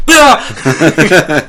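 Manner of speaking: short, loud, high mock scream of fright. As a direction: yelp as if a jump scare hit. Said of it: "I'm gonna be like".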